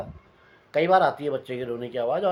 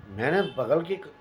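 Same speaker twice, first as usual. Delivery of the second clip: usual speaking voice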